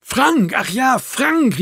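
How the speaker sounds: high, drawn-out exclamation; calling out. A narrator's reading. Marco suddenly recalling the name, calling out. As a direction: cry out as it dawns on you. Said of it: "rief Marco".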